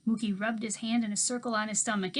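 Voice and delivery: ordinary delivery, nothing marked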